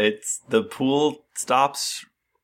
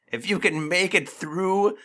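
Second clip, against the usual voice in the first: villain voice; 6 semitones higher than usual. Acted. Necromancer's challenge.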